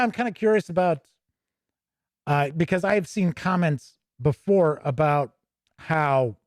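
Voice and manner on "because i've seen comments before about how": ordinary delivery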